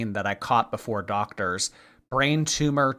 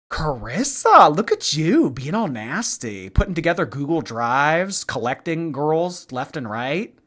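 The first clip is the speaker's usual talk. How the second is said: playful sassy teasing; 4 semitones higher, 4 dB louder than usual. Pretend. Streamer ribbing a chatter for being nasty, playful sass. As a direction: tease them with exaggerated sass.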